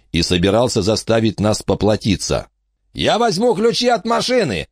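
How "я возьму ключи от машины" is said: high, sneering thug voice; mock the crazy old lady. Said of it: "сказал он".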